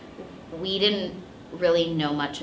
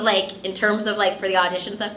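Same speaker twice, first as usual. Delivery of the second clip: ordinary delivery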